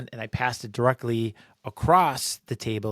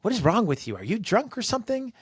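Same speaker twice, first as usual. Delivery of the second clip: indignant accusation; 10 semitones higher than usual. Retold or imitated. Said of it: "She's like".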